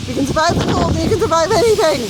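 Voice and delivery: loud and fast over roaring gusts; shouting over wind. Real, not acted. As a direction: raise your voice to beat the wind. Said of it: "Hope you can hear me over this wind".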